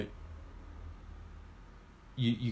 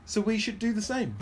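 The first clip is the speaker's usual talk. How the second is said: lively, raised pitch all through; excited high voice; 10 semitones higher, 4 dB louder than usual. Real, not acted. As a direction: say it bright and high.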